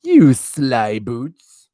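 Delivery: teasing. Real, not acted. Teasing the cat.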